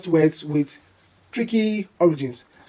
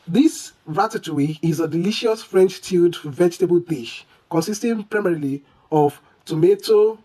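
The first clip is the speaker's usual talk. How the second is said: ordinary talk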